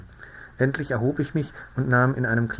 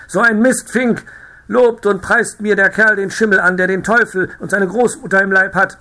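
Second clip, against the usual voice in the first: much higher and louder, raging; furious yelling; 9 semitones higher, 8 dB louder than usual. A narrator's reading. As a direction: scream it at the top of his voice. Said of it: "schrie der Reiter". (zorniges Schreien)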